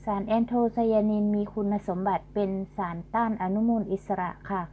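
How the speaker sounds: normal talking voice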